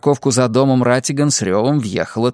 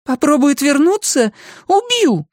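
high-pitched question, higher angry punch; enraged woman's outburst; furious threat. A narrator's reading. Woman threatens to kill her.

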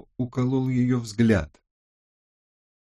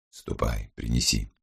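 very low, soft command; low-voiced boss; 11 semitones lower, 5 dB quieter than usual. A narrator's reading. Criminologist sends his officer to fetch it, low and quiet. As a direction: give the order quietly, deep in pitch.

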